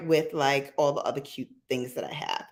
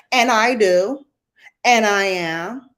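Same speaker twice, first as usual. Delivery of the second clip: defiant; 3 semitones higher, 6 dB louder than usual. Real, not acted. Defiant answer to critics.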